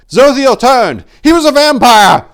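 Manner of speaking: loud, high frantic shout; shouting back. Acted. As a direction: yell the news across. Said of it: "shouting back".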